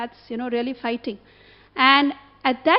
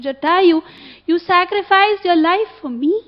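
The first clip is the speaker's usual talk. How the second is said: raised, tearful voice of Sita; tearful cry; 5 semitones higher, 5 dB louder than usual. Retold or imitated. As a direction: call to him through tears, lightly voiced. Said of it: "she's crying".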